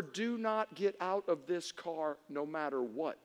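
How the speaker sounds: slow, low, soft command; mom's stern warning. Retold or imitated. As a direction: spell out the order quietly and slowly. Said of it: "My mom, her last words were".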